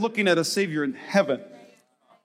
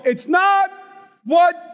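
punchline call; 7 semitones higher, slower than usual. Acted. Pastor's punchline repeated.

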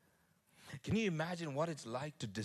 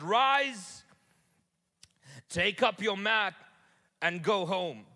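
high, loud, slow command; commanding Jesus; 8 semitones higher, 7 dB louder, slower than usual. Acted. Jesus commanding the lying man, loud solemn command. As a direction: proclaim each word like an order.

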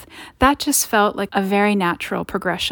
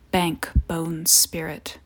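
whispering; 3 semitones lower, 6 dB quieter, much slower than usual. Acted. Eerie chant by strange men.

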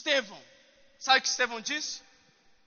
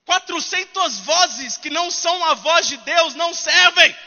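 forceful preaching; 4 semitones higher, 8 dB louder than usual. Real, not acted.

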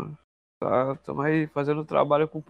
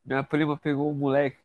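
usual speaking voice

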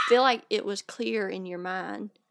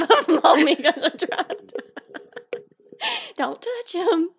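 high-pitched words through giggles; laughing; 7 semitones higher, 5 dB louder than usual. Real, not acted. Telling co-host about pimples, laughing. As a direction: talk while breaking up laughing.

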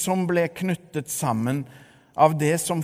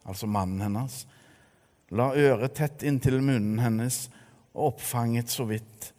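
normal talking voice

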